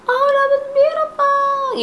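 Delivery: hammed-up sweet voice; fake praise. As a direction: girls faking delight for A. Girls faking praise.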